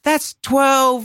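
high, loud disbelief at the years; incredulous. Real, not acted. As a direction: stretch it out like you can't believe it. Stresses how long twelve years felt.

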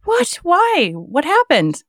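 high-pitched disbelief; startled, wanting an explanation; real shock. Real, not acted. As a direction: react stunned, pitch leaping on each question. Host reacts to comedy retirement news.

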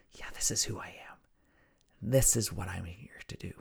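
soft, quick whisper to yourself; whispered self-reminder. Shown as a demonstration. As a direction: breathe it to yourself like a private vow.

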